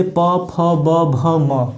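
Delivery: "प, फ, ब, भ, म" as separate letter sounds; lip consonant demonstration. Shown as a demonstration. Teacher gives example letters, "प, फ, ब, भ, म" pronounced singly. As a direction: sound out each of "प, फ, ब, भ, म" with the lips. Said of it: "इसका जब भी उच्चारण करेंगे".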